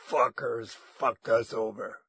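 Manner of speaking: slow, loud curse; loud, deliberate ranger's oath; bitter ranger. A narrator's reading. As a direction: drag it out loudly, as a bitter gunman.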